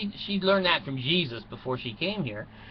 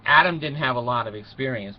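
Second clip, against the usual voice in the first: ordinary talk, nothing marked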